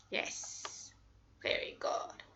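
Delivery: soft whispered yes very good; whispered praise. Real, not acted. Teacher to student, whispered praise.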